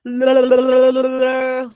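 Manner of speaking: big loud high laugh. Real, not acted. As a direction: burst out laughing. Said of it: "I'm over here like".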